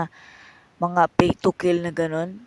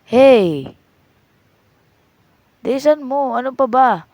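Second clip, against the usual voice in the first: annoyed yell; 4 semitones higher, 10 dB louder, slower than usual. Acted. Vendor yelling at customer.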